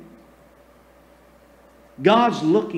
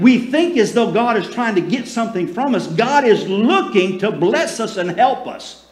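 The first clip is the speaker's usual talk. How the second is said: raised, louder preaching voice; full-force preaching; 4 semitones higher, 3 dB louder than usual. Acted. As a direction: preach it out with fire to the congregation. Preaching about God's protection.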